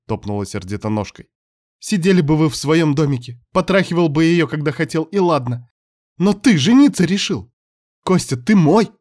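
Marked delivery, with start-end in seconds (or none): 1.8-8.9 jealous witch. Acted.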